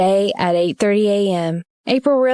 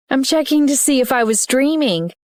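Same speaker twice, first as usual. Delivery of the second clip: innocent; 6 semitones higher than usual. A narrator's reading. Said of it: "April said innocently".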